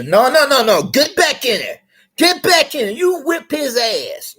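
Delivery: loud, high-pitched parental shouting; mock parent yelling. Parent yelling at son who backed off a fight, loud. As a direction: yell at the son to get back in the fight.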